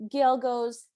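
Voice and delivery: ordinary talk, nothing marked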